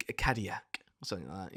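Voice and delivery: quick whispered self-correction; whispered aside. Real, not acted. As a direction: mutter the correction to yourself.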